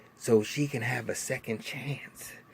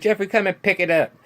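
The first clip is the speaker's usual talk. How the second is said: higher and louder mock command to her son; bossy call; 9 semitones higher, 9 dB louder than usual. Mother ordering her son to pick up the coat, loud. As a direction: bark it across the room.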